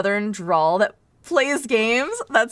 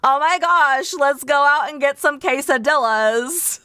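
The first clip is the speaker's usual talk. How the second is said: gushing Valley Girl imitation, slightly raised; hammed-up California girl; 2 semitones higher than usual. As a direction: play an excited Californian girl, mangling the Spanish. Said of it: "it's not like I'm saying it like quesadilla".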